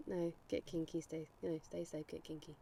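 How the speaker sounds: ordinary talk, nothing marked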